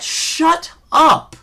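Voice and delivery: sudden high yell at the ref; shouted shut up. Retold or imitated. Fan yelling back at the ref in mock anger, loud and high.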